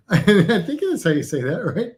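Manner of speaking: normal talking voice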